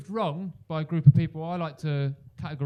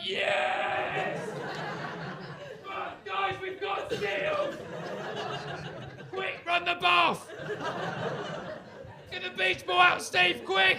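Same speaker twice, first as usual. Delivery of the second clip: very high, slow thrilled yelling; overjoyed shouting; much slower than usual. Pretend. Comedian imagining seals at home, ecstatic high shouting. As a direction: squeal with delight at the seal. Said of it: "I'd be like".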